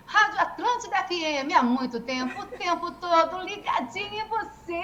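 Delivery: high, bright radio jingle voice; a lightly voiced radio announcer; imitating Lizzy. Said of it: "Era mais ou menos assim".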